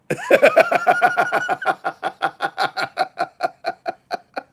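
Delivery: real high-pitched laughing fit, loud then tapering; long loud fit of high laughter; long high cackle. Real, not acted. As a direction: burst out in helpless, squealing laughter. Reacting to a funny chat message.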